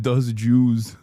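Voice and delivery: lightly voiced senator's gaffe, scoffing; mocking Ted Cruz's slip. Quoting the senator's blunder back in a mock voice. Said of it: "He said".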